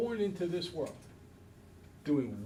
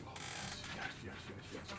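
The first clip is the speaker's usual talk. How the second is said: whispered yes; 16 dB quieter than usual. Retold or imitated. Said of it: "He's like".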